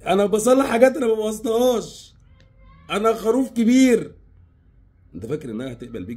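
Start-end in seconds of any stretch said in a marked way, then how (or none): 0.1-4.1 much higher, louder, silly put-on voice of a fool; mocking sheep voice. Mocking the sheep who fixes her mess, higher and louder. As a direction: ham it up like a proud dim-witted man. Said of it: "الخروف ده يبقى هو فرحان".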